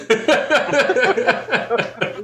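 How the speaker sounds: real burst of high laughter; loud laugh. Real, not acted. Laughing at the punchline of his story, loud real laughter. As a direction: crack up at your own story.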